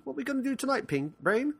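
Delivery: very high-pitched cartoon mouse voice; Pinky. Podcaster imitating a cartoon mouse asking his partner, high squeaky voice. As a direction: squeak it out as a silly cartoon sidekick. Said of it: "you'll always hear Pinky".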